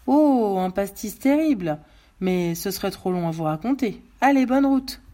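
exclamation haute et forte de pompiste; pompiste jovial et bavard. Acted. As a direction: raconte en commère, puis lance un salut sonore. (pompiste expansif, voix qui porte)

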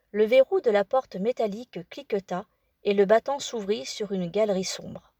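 ordinary delivery, nothing marked

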